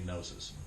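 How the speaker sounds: "gnosis" with a silent G; English pronunciation. Shown as a demonstration. Teacher showing how English would simply say it, "gnosis" without the G. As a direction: start "gnosis" straight on the N. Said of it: "In English, we would simply say".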